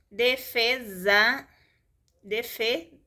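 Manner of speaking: "Defesa" drawn out, split into de-fe-sa; syllable by syllable. Shown as a demonstration. Teacher showing student how to separate syllables, syllable by syllable. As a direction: say "Defesa" slowly, one syllable at a time. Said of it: "separar sílabas".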